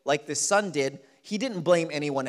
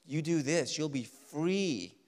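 sin whispering a lure, much lower and softer; tempting voice of sin; 4 semitones lower, 6 dB quieter than usual. Acted. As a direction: coax like a temptation, low and quiet. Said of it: "Sin promises freedom".

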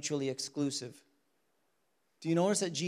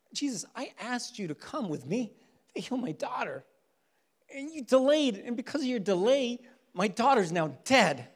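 angry complaint to Jesus; 7 semitones higher than usual. Retold or imitated. A father berating Jesus for delaying, higher and accusing. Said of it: "I would be like".

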